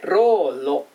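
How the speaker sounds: ローロ with a trilled tongue-tip r; rolled r. Shown as a demonstration. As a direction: roll the r in ローロ. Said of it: "巻き舌のローですね".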